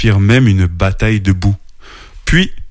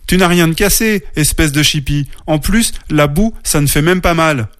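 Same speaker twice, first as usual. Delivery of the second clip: higher-pitched brotherly grumble; grumbling; 7 semitones higher than usual. A narrator's reading. Little bear to his muddy sister, grumbling. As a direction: scold lightly, as an irritated child. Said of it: "ronchonna-t-il".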